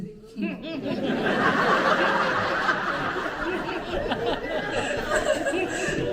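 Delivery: long breathless laugh; real laugh at own story. Real, not acted. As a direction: laugh helplessly. Laughs at the trap question.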